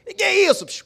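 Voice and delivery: high, loud confrontation; angry challenge. Retold or imitated. Uncle bursting in on the father, angry and loud. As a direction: storm in and demand an answer.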